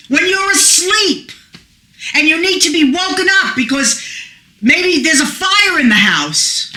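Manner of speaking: ordinary delivery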